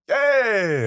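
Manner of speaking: high, drawn-out gleeful yay; mock cheer. Teasing friends who just agreed, high mock cheer.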